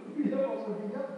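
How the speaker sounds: laughing. Retold or imitated.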